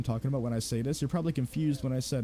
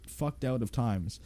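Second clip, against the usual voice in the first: ordinary delivery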